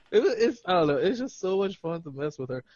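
ordinary talk

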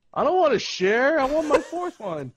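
put-on high, loud childish protest; petulant, hammed-up complaint; mock petulant whine. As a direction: imitate a sulky kid refusing to share. Acts out friend refusing to share.

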